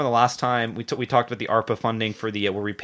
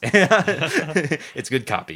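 slow, drawn-out laughing praise; 4 semitones higher, much slower than usual. Real, not acted. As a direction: let it stretch out through a laugh.

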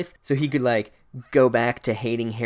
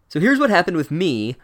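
eager schoolgirl; 5 semitones higher, 6 dB louder than usual. Retold or imitated. Hermione launches her story.

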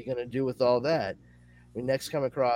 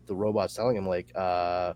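normal talking voice

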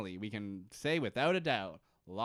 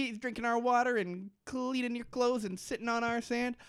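imitating Sun; 9 semitones higher than usual. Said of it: "That's how she sounds".

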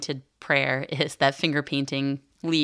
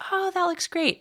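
very high, sing-song praise; absent-minded mom praise. Retold or imitated. Mom calling praise across the room to her son in a very high voice. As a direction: praise it without looking, in a high voice.

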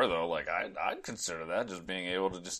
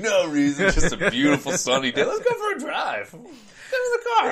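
high, laughing, innocent voice; sly grinning president; 12 semitones higher, 7 dB louder than usual. Pretend. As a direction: sing it out with a smug grin. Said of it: "with a big dumb grin on my face".

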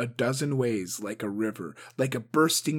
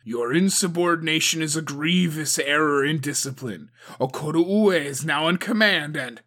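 louder, firm scolding; stern rebuke; 5 semitones higher, 7 dB louder than usual. A narrator's reading. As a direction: reprimand her sharply. Agatoki rebukes Suko.

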